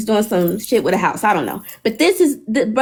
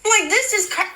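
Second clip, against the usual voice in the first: higher, breaking off in disbelief; stunned; 9 semitones higher, 3 dB quieter than usual. Real, not acted. Still reeling from the news, high stunned voice. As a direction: cut yourself off, floored.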